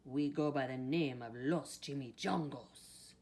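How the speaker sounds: put-on growled gang-leader dog with Spanish accent; growling Chihuahua. A narrator's reading. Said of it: "growled Don Diego".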